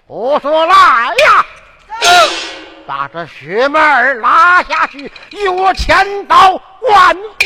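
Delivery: 提高嗓门喝令推出斩首; 威严地大声传令; 怒喝下令. Acted. 父王下令斩人. (父王怒喝传令斩薛满儿)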